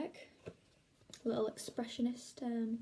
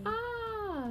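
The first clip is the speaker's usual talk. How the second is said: a sharp, very high "Ah!"; sudden piercing cartoon scream; high scream of fright; 11 semitones higher than usual. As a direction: shriek it as the hero is startled. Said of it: "screamed Strawberry Splatman".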